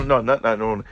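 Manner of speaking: ordinary delivery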